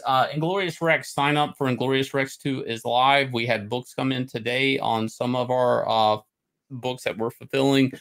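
usual speaking voice

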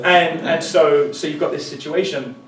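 ordinary talk, nothing marked

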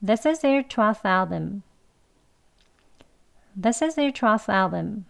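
This is their twelfth album said fast with the words linked; quick. Shown as a demonstration. Teacher demonstrating the fast connected sentence. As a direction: run it together, no f in twelfth. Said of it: "빨리 해볼게요".

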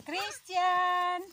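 loud call across the yard. Real, not acted. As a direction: shout a name to someone far off. Calling someone from afar.

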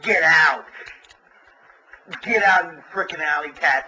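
yelling at the cats to get out, loud; retold yell at intruding alley cats; angry shout. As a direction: shout them out of the room. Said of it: "I got pissed off".

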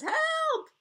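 high, tiny cry for help; a cry from upside down; yelling for help. Acted. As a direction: call out like someone small and far off. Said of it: "she's yelling".

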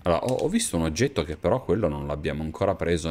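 ordinary delivery

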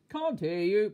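very loud, high shopkeeper shout; booming holler; thundering shout. A narrator's reading. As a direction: thunder it at the customer. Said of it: "bellowed the greengrocer".

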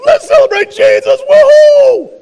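high, loud whooping cheer. Pretend. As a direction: shout it like a party. Worshipper cheering in heaven.

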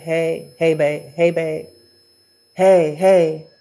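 lightly voiced deep-voiced boyfriend; attempt at his deep voice. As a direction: try a man's low voice and not quite get there. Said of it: "Can I do his voice? His voice is too deep".

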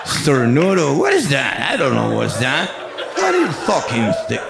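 puzzled American cop voice; American cop English. Retold or imitated. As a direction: sound baffled by the foreign word. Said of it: "ahí empieza un inglés raro".

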